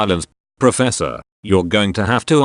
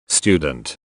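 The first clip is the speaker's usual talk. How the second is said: normal talking voice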